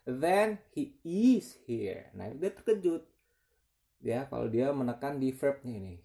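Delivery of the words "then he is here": is stretched out, slow and high; surprised stress on is. Shown as a demonstration. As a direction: sound surprised, lean hard on "is". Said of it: "ada penekanan ya".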